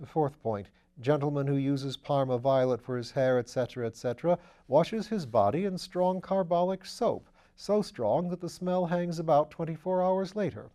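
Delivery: lightly voiced upper-class gent; airy, amused aristocrat; breezy gentleman voice. A narrator's reading. Wimsey's voice in the reading.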